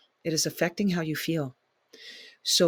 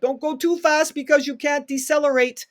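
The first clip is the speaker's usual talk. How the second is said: high, louder warning cry; mock alarm; 10 semitones higher, 5 dB louder than usual. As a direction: call out a startled warning to a treadmill user. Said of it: "I'm like".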